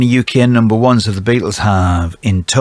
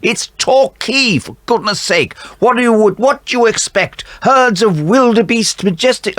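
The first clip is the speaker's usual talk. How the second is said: much higher exasperated comedy voice; Basil Fawlty quote; 10 semitones higher than usual. Retold or imitated. Quizmaster quoting Basil Fawlty, high exasperated impression. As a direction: quote Fawlty Towers in mock outrage. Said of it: "Enough of the quotes from comedy programs".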